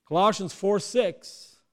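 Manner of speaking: ordinary delivery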